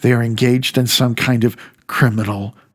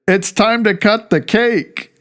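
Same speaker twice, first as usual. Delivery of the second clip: mock host; 10 semitones higher than usual. Host interrupts guest's story.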